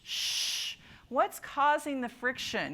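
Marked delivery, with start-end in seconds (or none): 0.0-0.8 "sh" held long without voice; a sustained rush of air on "sh"; SH sound. Shown as a demonstration. Teacher repeating for the class, long voiceless SH. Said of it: "So say sh".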